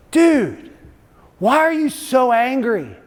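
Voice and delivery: high, drawn-out Californian dude; Californian accent. Retold or imitated. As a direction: put on a loud, high Californian surfer accent. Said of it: "in his Californian accent".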